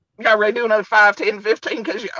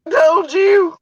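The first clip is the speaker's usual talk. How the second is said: gleeful shout; 9 semitones higher, 6 dB louder than usual. Real, not acted. Gloating outburst at the screen.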